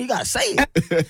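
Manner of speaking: high, laughing retold insistence; coaching grown-ups, imitated. Dad in the studio pushing his small son, laughingly imitated urging. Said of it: "They said".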